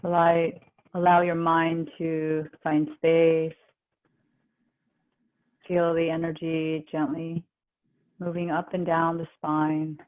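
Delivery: calm, even, unhurried relaxation guide; guided meditation. Real, not acted. Yoga teacher guiding the class into relaxation, calm guided meditation.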